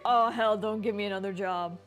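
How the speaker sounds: normal talking voice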